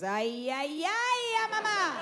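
exaggerated Spanish "ay", climbing very high; hammed-up disgust with a soaring last "ay"; disgusted toddler wail. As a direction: draw it out into a rising, exasperated child's whine. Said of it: "she gets disgusted".

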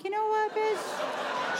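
high-pitched comic exasperation; mock indignant retort. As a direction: clap back in a squeaky, hammed-up huff. Mother snaps back at toddler.

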